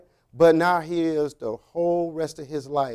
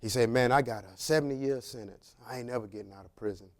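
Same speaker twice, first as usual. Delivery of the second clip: mock whisper; 5 semitones lower, 5 dB quieter than usual. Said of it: "he started whispering in my ear".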